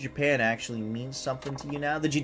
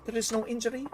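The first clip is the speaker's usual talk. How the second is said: higher, hammed-up broken-English foreign accent; a foreign diva's shaky fake accent, higher pitched; questionable foreign accent; 7 semitones higher than usual. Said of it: "my questionable accent of the day".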